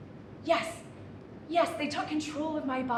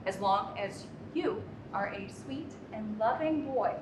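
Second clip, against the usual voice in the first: usual speaking voice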